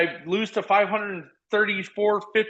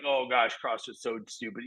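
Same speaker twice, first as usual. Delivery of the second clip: mocking scoff; 5 semitones lower, 7 dB quieter than usual. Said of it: "people are out there like".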